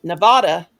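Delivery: "Nevada" with an ah in the middle; outsider's pronunciation. Retold or imitated. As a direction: say "Nevada" as nuh-VAH-duh. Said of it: "and I said".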